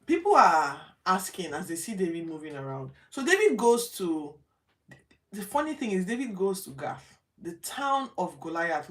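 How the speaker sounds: normal talking voice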